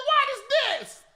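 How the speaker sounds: high, loud shocked question; incredulous What is this. Retold or imitated. Host reacting to the crying prayer call, shocked and loud. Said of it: "I said".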